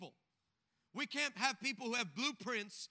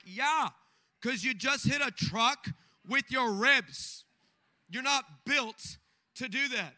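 loud retort; 3 semitones higher, 9 dB louder, slower than usual. Retold or imitated. Retorts to injured friend.

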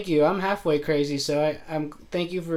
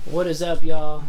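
loud greeting; 10 dB louder than usual. Real, not acted. Podcast opening greeting.

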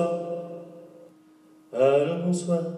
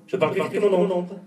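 "Je parle plus vite que mon ombre" rattled off fast; fast talk; 4 semitones higher, faster than usual. Shown as a demonstration.

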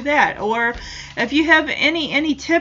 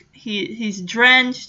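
ordinary talk, nothing marked

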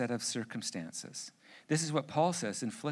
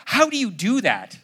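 amazed wife; 6 semitones higher, 10 dB louder than usual. Retold or imitated. Wife amazed at calmed kids.

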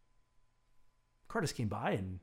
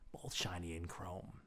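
slow breathy whisper of "shiny and chrome"; 8 semitones lower, 9 dB quieter than usual. A narrator's reading. Storyteller hushing "shiny and chrome" about the instruments. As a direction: hush the words "shiny and chrome".

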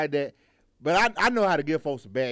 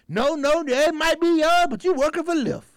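high, drawn-out mock scolding; imitated indignant passenger, pitched way up; old lady arguing back; 9 semitones higher, slower than usual. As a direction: put the driver in his place, hammed up. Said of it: "she say".

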